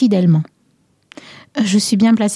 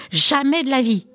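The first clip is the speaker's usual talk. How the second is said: a raised, higher mock yell of denial; 5 semitones higher than usual. A narrator's reading. The furious husband denies everything, mild mock yell. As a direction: fling the denial out sharply, understated. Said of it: "hurla-t-il".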